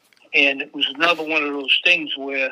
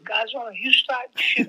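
teasing guard call; 8 semitones higher than usual. Retold or imitated. Security guard teases him.

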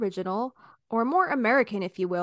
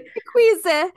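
put-on Italian accent; 8 semitones higher, 10 dB louder, slower than usual. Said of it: "making all my words Italian".

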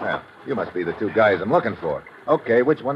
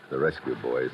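hushed; 5 semitones lower, 7 dB quieter than usual. Acted. Hero hiding from the rescue party, whispering to prisoners. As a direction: lower and softer, hidden in the brush.

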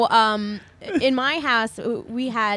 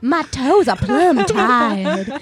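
laughing diva drawl; 2 semitones higher, 8 dB louder, much slower than usual. Pretend. Continuing the starlet bit.

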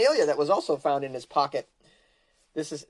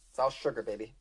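ordinary talk

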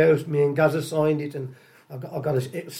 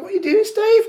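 excited; 4 dB louder than usual. Retold or imitated. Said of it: "and I'm going".

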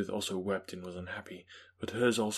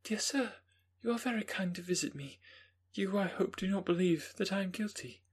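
put-on young Justine; 11 semitones higher than usual. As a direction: voice Justine as a calm young woman. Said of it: "she approached me and said".

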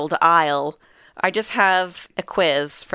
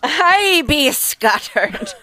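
mock Irish accent; 9 semitones higher, 4 dB louder, much slower than usual. Playing with Irish slang.

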